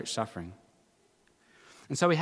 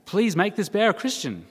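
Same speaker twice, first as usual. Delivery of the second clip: higher, louder desperate plea; crying out; 4 semitones higher, 5 dB louder than usual. Acted. Cornered atheist praying as a bear nears, crying out. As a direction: plead loudly for rescue. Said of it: "suddenly he cries out".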